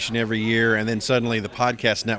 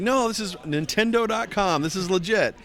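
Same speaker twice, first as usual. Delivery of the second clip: higher, lightly voiced excited girlfriend; 9 semitones higher than usual. Fiancee waking him on his birthday with Ryu news, higher excited voice. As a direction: imitate an eager girlfriend breaking news. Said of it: "And it's like".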